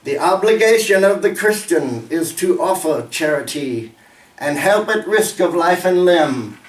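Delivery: slow, declaimed winter verse; measured, emphatic poetry reading with falling line ends; poem recitation. Acted. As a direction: recite it gravely, swelling on opening lines. Recites poem titled Charity.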